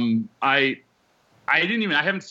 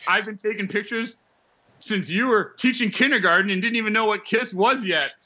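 higher pitched snappy comeback; a touch of mock defiance, voiced up; retold comeback; 6 semitones higher than usual. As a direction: fire it right back at him. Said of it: "and I go".

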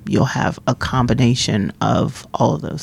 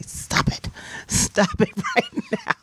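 mother scolding; 5 dB quieter than usual. Retold or imitated. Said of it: "she would say".

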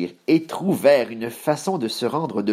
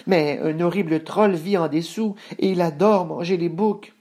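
higher-pitched goat voice; middle billy goat, cautious; 8 semitones higher than usual. Acted. Voices Moyen Bouc in the tale.

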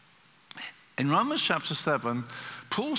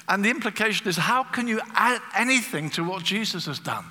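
usual speaking voice

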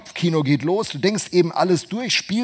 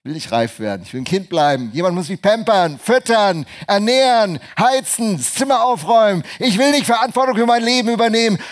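put-on higher, louder spoiled-child whine; childish complaining; 7 semitones higher, 4 dB louder than usual. Preacher mocking a believer who wants pampering, high whiny voice.

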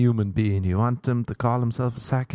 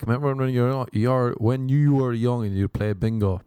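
normal talking voice

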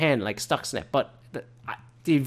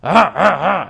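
mock stammer; 6 semitones higher, 7 dB louder than usual. Mocking a stammering ranter.

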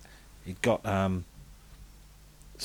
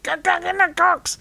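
high squeaky ventriloquist doll voice; puppet voice. Retold or imitated. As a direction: shriek it like a puppet. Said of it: "he was doing the voice".

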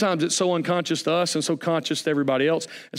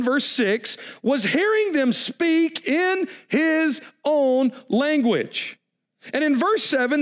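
fiery preaching; 9 semitones higher than usual. Acted. Preaching at full force.

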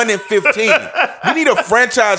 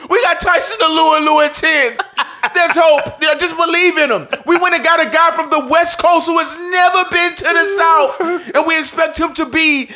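high, mocking sing-song fan voice; hopeful fan, hammed up high; 7 semitones higher than usual. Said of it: "they come in year after year saying".

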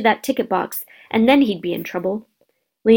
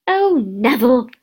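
slow, louder exasperated granny; exasperated sigh; 3 semitones higher, 4 dB louder than usual. A narrator's reading. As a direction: sigh at a hopeless grandson. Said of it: "he heard the old woman sigh".

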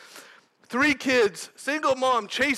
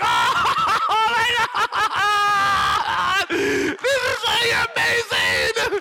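high, loud laughing wail; uncontrollable laughter; 9 semitones higher, 4 dB louder, slower than usual. Retold or imitated. Student overcome by joy after bad news, uncontrollable laughter. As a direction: re-enact helpless laughter through tears, words stretched out. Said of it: "I start laughing uncontrollably".

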